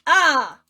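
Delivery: loud, high falling "ah" cry; mock scream. Narrator voicing a cry at the punishment, mock scream. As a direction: let out a short hammed-up scream.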